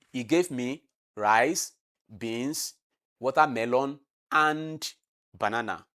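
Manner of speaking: slow list, pausing after each item, coming down on "banana"; falling tone at the end. Shown as a demonstration. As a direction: list "rice, beans, watermelon" with pauses, then drop "banana". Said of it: "You see, it comes down".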